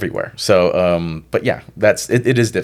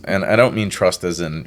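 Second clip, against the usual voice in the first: ordinary delivery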